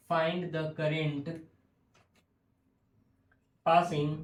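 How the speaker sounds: very slow, with long pauses; slow dictation. Real, not acted. Teacher dictates the question to students, slow dictation. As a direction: dictate it in small chunks, drawing words out.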